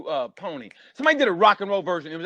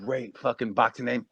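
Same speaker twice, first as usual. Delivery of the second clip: normal talking voice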